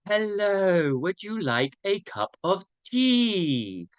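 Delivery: slow, exaggerated British accent, words drawn right out; hammed-up British tea-time accent; lovely British accent. Host filling time with an accent as background music, playful exaggerated British. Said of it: "my lovely accent".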